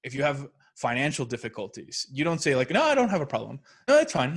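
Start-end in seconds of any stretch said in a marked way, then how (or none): 2.7-4.3 loud, high, dismissive brush-off; mock breezy denial. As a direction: wave the problem away loudly, mocking it. Mocking someone in financial denial.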